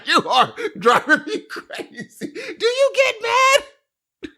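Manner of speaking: high, drawn-out mock shriek; hammed-up hysterical girlfriend; crazy girlfriend. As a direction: wail it slowly at a very high pitch. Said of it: "she'd go crazy".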